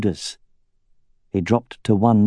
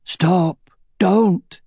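high, thin, strained cry; weak cry; 8 semitones higher than usual. A narrator's reading. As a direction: call out feebly, almost failing. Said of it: "cried out in a weak voice".

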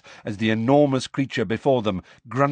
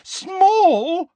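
much higher and louder, rising; shrill disbelief; 8 dB louder than usual. A narrator's reading. Hermione repeating the word, now shrill and loud. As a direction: shriek it in outraged disbelief. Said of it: "said Hermione".

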